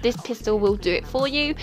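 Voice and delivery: ordinary talk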